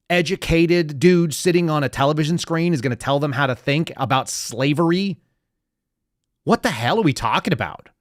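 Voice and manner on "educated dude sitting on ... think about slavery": usual speaking voice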